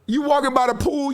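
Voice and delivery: ordinary talk, nothing marked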